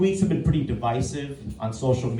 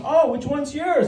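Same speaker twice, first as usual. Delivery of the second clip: higher, slow chirpy mother voice; chirpy mom; 9 semitones higher than usual. Retold or imitated. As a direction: play the nosy school mom, bright and sweet. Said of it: "this mother kept going".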